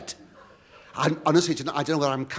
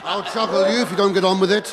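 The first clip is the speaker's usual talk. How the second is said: mock threat; 6 semitones higher, 7 dB louder than usual. [name] heckles the announcer.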